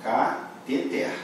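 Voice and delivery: cateter drawn out slowly as ca-te-ter, stress on the last syllable; syllables split apart. Shown as a demonstration. Teacher slowly splitting cateter for students. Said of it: "Eu separo essa sílaba assim".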